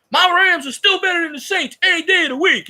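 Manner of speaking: hammed-up high mock of a pundit's tantrum. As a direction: lampoon his Rams bragging. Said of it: "goes on a tantrum".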